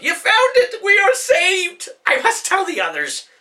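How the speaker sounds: very high, loud elf yell; overjoyed shout. Acted. Caleb spots the director and rushes off, overjoyed shout. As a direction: cry out in sheer relief.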